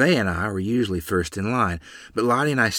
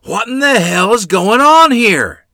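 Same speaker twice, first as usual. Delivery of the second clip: furious father's roar, much louder and higher; booming growl; 13 semitones higher, 11 dB louder than usual. A narrator's reading. Father catches two children idle, booming angry shout. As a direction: thunder it slowly at the children. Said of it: "the booming voice of my father".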